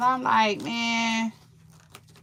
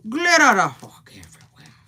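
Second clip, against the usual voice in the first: 1 exasperated whisper; 3 semitones higher, 11 dB louder than usual. Real, not acted. Exasperated at glitter mess.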